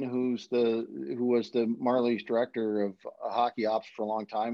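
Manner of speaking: ordinary talk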